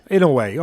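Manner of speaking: "Inoue" ending in "way", repeating the suggested form. Shown as a demonstration. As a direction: echo "Inoue" as IN-uh-way. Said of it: "I would say Inoue".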